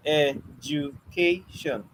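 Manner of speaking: Education stretched out slowly, ed-u-ca-tion, each syllable drawn apart. Shown as a demonstration. Teacher counting the syllables of Education out slowly for the class. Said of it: "It has four syllables".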